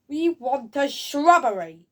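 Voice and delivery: high, loud, slow mock demand; demanding knight. Reviewer acting the knights' demand, loud and drawn out. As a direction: stretch it out as a silly knight.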